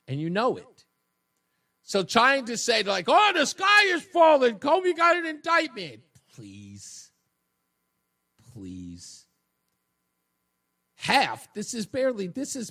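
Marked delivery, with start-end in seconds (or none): 3.1-6.0 mocking shout of the sky falling; mock panic. 6.4-9.3 much lower, soft scoffing please; dismissive scoff. Real, not acted.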